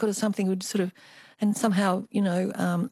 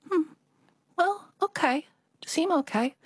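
put-on much higher, hesitant Chinese-accented mum; 10 semitones higher than usual. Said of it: "I always have to do the Chinese accent".

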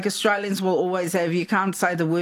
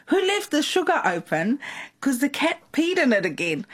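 higher, calling from the kitchen; retold yell; 6 semitones higher than usual. Deacon's wife yelling at kids from the kitchen, mock yell. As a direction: yell it through the house at the kids. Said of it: "she yelled at the kids".

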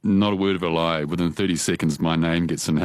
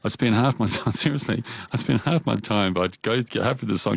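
ordinary talk

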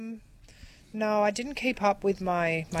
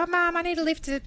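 put-on higher-pitched pestering child; whining kid to mum; 9 semitones higher than usual. Kid asking mum for a lift, higher pestering voice. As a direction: lightly mimic a kid wheedling a favour. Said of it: "if your kids go".

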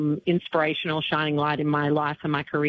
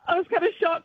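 ordinary talk

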